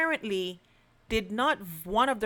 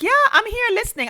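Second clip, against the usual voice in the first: retold quick "yes, I'm listening" in a much higher, louder voice; high, hurried reply; 14 semitones higher, 7 dB louder, much faster than usual. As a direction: pipe up fast and high, as if caught silent. Retold phone reply after silence.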